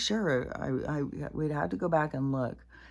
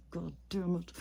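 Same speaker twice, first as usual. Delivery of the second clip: muttered curse; 3 semitones higher, 7 dB quieter than usual. Real, not acted.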